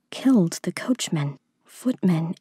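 ordinary delivery, nothing marked

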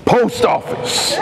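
slow, breathy laughing read of the note; amused, slowed repeat of the note. Real, not acted.

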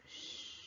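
long, soft shushing hiss; drawn-out unvoiced shh of spraying water; imitated water spray. A narrator's reading. Water put on the fire.

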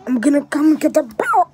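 quacky, squawky cartoon duck voice; Donald Duck voice. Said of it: "do Donald Duck voice impression".

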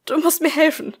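high, loud panicked cry for help; panicked plea. A narrator's reading. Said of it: "Ihre Stimme klang panisch".

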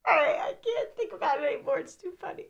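tearful, squeaky real laughter; fading out breathless with laughter; crying laughing. Real, not acted. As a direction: squeeze the words out through laughs. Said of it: "I was actually crying laughing".

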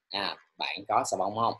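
normal talking voice

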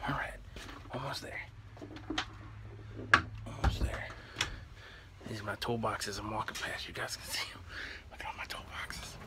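hushed whisper while sneaking out. Real, not acted. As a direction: keep it to a whisper. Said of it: "really quiet".

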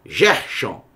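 "Gershon" low, starting with zh; Portuguese reading. Shown as a demonstration. Teacher repeating the Portuguese reading, g softened to zh. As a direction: say "Gershon" as "Zhérshon". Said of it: "no português".

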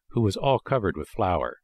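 plain reading voice

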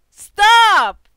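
loud desperate yell to stop; real panicked command shout; frightened shout. Real, not acted. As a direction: scream at the game to make it stop. Scared by game sounds.

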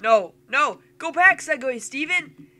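loud, high commands; urgent calling out. Real, not acted. Player steering Steven back in the game, loud urgent calls. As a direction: shout orders at a game character.

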